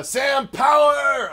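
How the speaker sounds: high, slow announcer shout of the name; hype shout. Pretend. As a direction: stretch it out like a ring announcer. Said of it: "had to do it that way".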